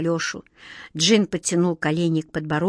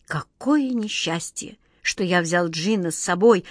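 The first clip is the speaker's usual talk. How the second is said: ordinary delivery, nothing marked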